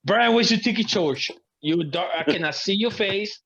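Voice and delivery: ordinary delivery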